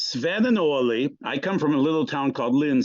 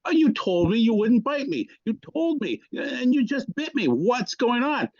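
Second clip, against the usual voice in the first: much higher, slowing at the end in mock outrage; a hammed-up cry of betrayal, pitched way up; betrayed driver; 9 semitones higher than usual. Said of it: "And the guy goes".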